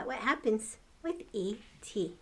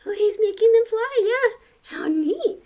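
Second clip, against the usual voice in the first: much higher, awed and louder; amazed; 13 semitones higher, 11 dB louder than usual. Real, not acted. Reacting to the picture for the kids, awed wow. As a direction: marvel at the flying fruit.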